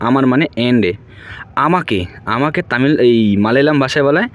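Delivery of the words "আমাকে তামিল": ordinary talk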